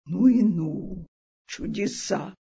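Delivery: usual speaking voice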